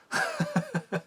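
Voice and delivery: high-pitched giggling laugh; helpless high laugh of disbelief. Real, not acted. Reviewer laughing at the absurd logo shot, high giggle.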